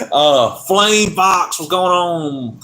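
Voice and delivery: put-on stretched, slow Southern accent greeting; slow southern drawl. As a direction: drag each word out like a Texan. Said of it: "your very southern accent".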